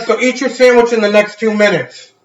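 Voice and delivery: loud, high-pitched retort; exasperated urging. Retold or imitated. Urging dad before he forgets again, loudly exasperated.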